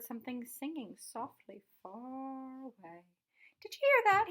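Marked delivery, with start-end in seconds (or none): 3.6-4.3 eager and much higher, louder; bursting with news he just heard; excited. A narrator's reading. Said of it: "he asked excitedly".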